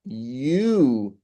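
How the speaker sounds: YOU stretched long with a rise and fall; single out YOU with a long swell; drawn-out, pointed YOU; stress on YOU. Shown as a demonstration. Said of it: "you're trying to say".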